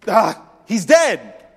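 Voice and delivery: slow, loud, heavy statement; blunt Jesus. Retold or imitated. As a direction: let it land slowly and bluntly.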